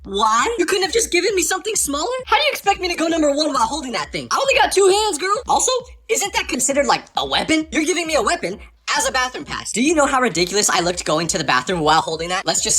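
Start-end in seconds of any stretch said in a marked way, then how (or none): 0.0-9.7 high-pitched comic indignation; incredulous protest. Retold or imitated. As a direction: argue in high, baffled disbelief with the teacher. Protesting the bat as bathroom pass.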